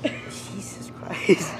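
slow breathless whisper. Real, not acted. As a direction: whisper it in shock. Shocked aside.

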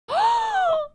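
very high-pitched gasp; excited gasp. Real, not acted. Player spots the selfie pose, delighted gasp.

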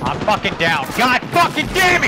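furious yell at getting knocked; man down shout. Real, not acted. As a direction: scream it out, enraged and loud. Downed in a firefight, yelling.

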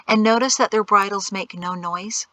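ordinary delivery, nothing marked

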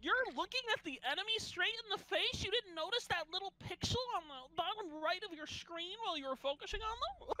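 sustained high-pitched sarcastic voice; mock incredulity. Player mocks friend's blame over screen indicator, high sarcastic protest. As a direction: pitch it way up and pile on disbelief.

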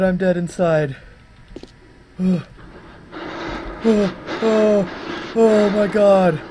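high and loud, frantic and breathless; panicking at a scary sound, can't cope; real panic. Real, not acted. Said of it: "I'm fucking shaking right now".

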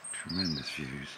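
normal talking voice

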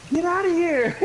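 high-pitched film-quote yelp; mock outraged cry. Player re-enacting a Forrest Gump scene, high mock cry. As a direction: order them out in a squeaky mock cry. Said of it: "such a funny part in Forrest Gump".

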